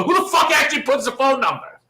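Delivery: high, incredulous shouted question; exasperated yell. Real, not acted. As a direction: shriek it in comic disbelief. Exasperated rant about being pranked.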